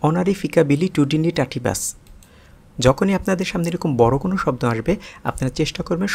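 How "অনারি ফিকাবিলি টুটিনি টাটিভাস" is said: Honorary ফিকাবিলি টুটিনি টাটিভাস run together in one smooth quick breath; said faster. Shown as a demonstration. Teacher showing learners honorary ফিকাবিলি টুটিনি টাটিভাস at quicker pace. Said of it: "আমি আবার উচ্চারণ করছি".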